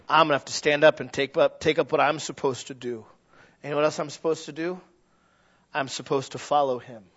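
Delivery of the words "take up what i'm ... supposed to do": ordinary talk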